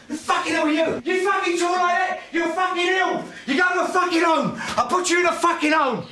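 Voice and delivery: high, full-throated furious tirade; out-of-control yelling, pitched right up; enraged shouting. Acted. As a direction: scream it at your son in a rage. Father's violent rage at his son continues.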